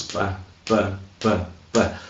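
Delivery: short separate beats, dropping softly; separate pulses. Shown as a demonstration. Teacher showing students air pulses, in separate beats. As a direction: let out each syllable as its own puff of air. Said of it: "ela é emitida em pulsos".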